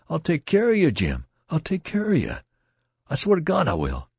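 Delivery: high, loud, frantic promise; hysterical cry. A narrator's reading. Youth swearing to protect his friend, hysterical. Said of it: "The youth cried out at him hysterically".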